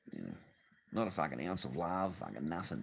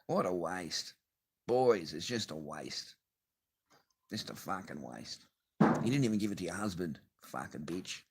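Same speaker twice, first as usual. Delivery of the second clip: normal talking voice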